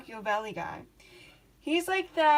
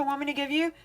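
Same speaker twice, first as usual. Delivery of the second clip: ordinary talk, nothing marked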